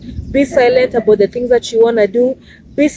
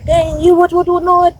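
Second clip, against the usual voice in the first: higher-pitched mocking insult; mimicking abusive strangers; 6 semitones higher than usual. To someone who stepped on him, mocking their abuse. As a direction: imitate the rude stranger's rant, pitch up. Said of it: "a lot of abusive words".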